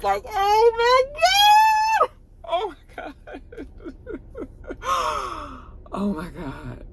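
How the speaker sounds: high, slow cry breaking into a sob; a fan tearing up over the show's ending. Retold or imitated. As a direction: wail then fade into a teary laugh. Reliving the emotional reunion.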